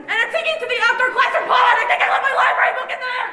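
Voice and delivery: high, fast, laughing panic; frantic laughing shout. Acted. Fleeing student yells to friends, laughing frantically. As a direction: laugh and yell it on the run.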